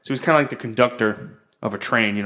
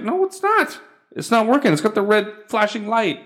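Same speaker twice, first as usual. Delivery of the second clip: very high, indignant comeback; retold exasperated protest; 9 semitones higher than usual. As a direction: shoot up in pitch to contradict them. Acts out protest to internet company.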